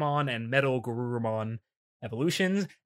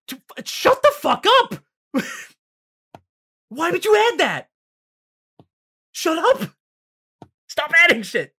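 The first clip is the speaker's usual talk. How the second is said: yelled much higher and louder; furious shout; 7 dB louder than usual. Real, not acted. To the dub writers, a real exasperated yell. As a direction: blow up at the screen. Yelling at dub's added joke.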